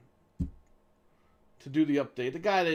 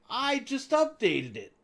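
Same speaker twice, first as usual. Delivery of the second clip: exasperated; 8 semitones higher, slower than usual. Retold or imitated. Exasperated reply to the clerk.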